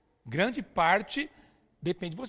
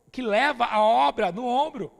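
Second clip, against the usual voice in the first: preaching shout; 3 semitones higher, 8 dB louder, slower than usual. Real, not acted.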